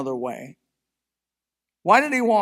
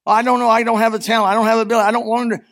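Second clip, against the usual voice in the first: higher, louder mock whine of a reluctant believer; whiny excuses; 5 semitones higher, 6 dB louder, much faster than usual. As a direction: whine every excuse like someone dodging the call.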